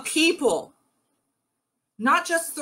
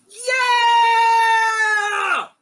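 long, high, loud crowd cheer; 11 semitones higher, 6 dB louder than usual. Acted. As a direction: cheer at full voice, holding the note. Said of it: "cheered Lu's aim".

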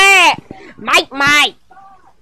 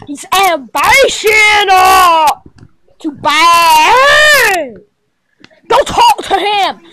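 ordinary delivery

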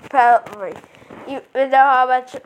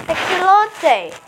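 slow, hammed-up French "Excellente!"; mock French cheer; French accent; 4 semitones higher, slower than usual. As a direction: stretch "Excellente!" like a stage Frenchwoman. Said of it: "The professor is, like, French".

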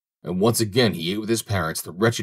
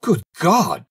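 high, loud burst of shock; astonished cry; 9 semitones higher, 5 dB louder than usual. A narrator's reading. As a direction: cry out as the old man, stunned. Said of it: "cried the old man".

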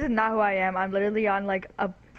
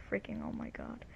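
breathy frustrated whisper; whispered mutter; 13 semitones lower, 16 dB quieter than usual. Real, not acted. Frustrated muttering after fight.

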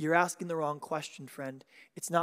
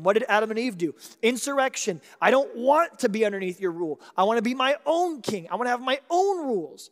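rising, louder confession, pressing harder line by line; preaching at full force; 3 semitones higher, 5 dB louder than usual. Acted. Preacher confessing himself the rebel, building loud.

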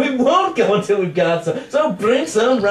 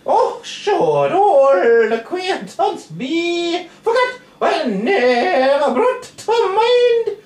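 high sung Scottish accent, badly done; hammed-up Scottish-Irish accent in song; 8 semitones higher, much slower than usual. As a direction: sing it slowly in a dreadful Scots brogue. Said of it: "That is the worst Scottish accent".

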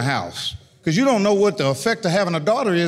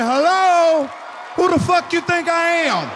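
mock outraged shout; 11 semitones higher, slower than usual. Dad yelling at boy hiding the weed, high shout. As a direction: blow up at the kid.

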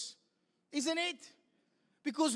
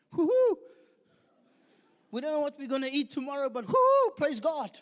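mock celebration; 7 dB louder than usual. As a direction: whoop it up like you won something. Jobless believer celebrating anyway.

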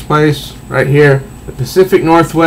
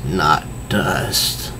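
much lower, much softer monster rumble; lightly voiced clay monster, low and soft; 9 semitones lower, 10 dB quieter than usual. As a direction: growl it low from deep in the throat. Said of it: "He says".

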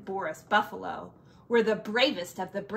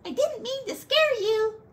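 much higher, squeaky little frog; tiny frog voice; 14 semitones higher, 4 dB louder than usual. Acted. The tiny frog reassures the scared bear, high squeaky voice.

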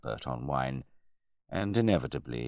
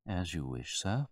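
deferential butler; 4 semitones higher, 5 dB quieter than usual. A narrator's reading. Butler gives way.

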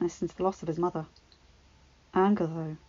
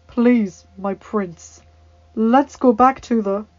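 pleading knight; 2 semitones higher, 9 dB louder, much slower than usual. Acted. Knight pleads with angry prince.

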